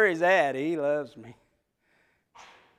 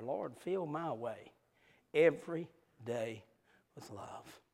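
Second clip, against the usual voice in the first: hushed, softer, slow prayer fading to a breath; lightly voiced quiet prayer; 3 semitones lower, 10 dB quieter than usual. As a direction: pray it gently under your breath. Praying a prayer.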